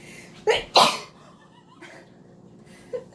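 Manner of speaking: loud high unvoiced laugh breaking out; burst of laughter. Real, not acted. Laughing at interrupted heartfelt story.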